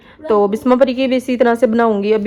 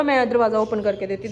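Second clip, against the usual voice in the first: ordinary talk, nothing marked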